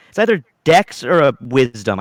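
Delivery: ordinary talk, nothing marked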